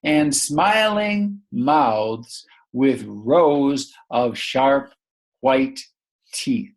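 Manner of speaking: very slow, word-by-word storybook reading; slow read-aloud. Shown as a demonstration. Teacher reading on with the child, slow and deliberate. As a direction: drag out each word for a young reader. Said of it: "let's continue the sentence".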